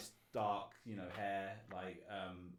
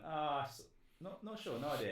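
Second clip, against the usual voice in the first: higher, stalling non-answer; hesitant dodge; 6 semitones higher than usual. Retold or imitated. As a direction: hedge it in a raised, halting voice. Dodging a guess with his barber.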